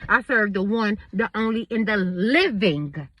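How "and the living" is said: "living" drawn out slow and low; stress on living. Real, not acted. Declaring the God she serves, emphatic "living". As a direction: lean slowly on "living". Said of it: "I gotta put emphasis on that".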